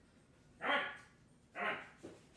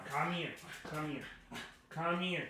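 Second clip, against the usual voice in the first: soft, rapid repeated beckoning; hushed, insistent calling; coaxing a pet; 19 dB quieter, faster than usual. Real, not acted. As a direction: coax the animal over quietly. Coaxing pet away from mic.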